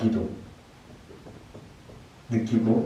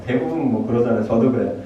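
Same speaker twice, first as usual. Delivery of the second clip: normal talking voice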